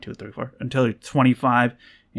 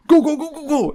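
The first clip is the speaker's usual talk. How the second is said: mock staff yell; 14 semitones higher, 5 dB louder than usual. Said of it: "the staff's like".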